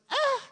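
high, startled yelp; mock scream. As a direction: shriek like you just saw a snake. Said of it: "I'd be like".

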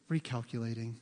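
soft, low, soothing GPS voice; imitated calm little navigation voice; calm, endearing. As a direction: say it gently, as if forgiving a mistake. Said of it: "In a very calm, almost endearing voice".

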